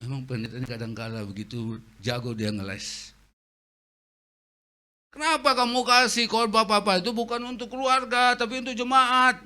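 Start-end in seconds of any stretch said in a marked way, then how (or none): 5.1-9.4 indignant son. Retold or imitated. Son scolds father.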